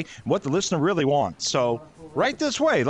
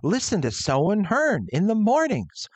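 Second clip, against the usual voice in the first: radio DJ; 2 semitones higher, slower than usual. Acted. [name] demonstrating vocal techniques for weekend show, radio announcer. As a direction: announce it with energy and enunciation. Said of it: "Okay, like this?".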